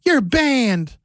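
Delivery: high, drawn-out pronouncement; mock banning. Host acting out Zach banning someone, mock pronouncement.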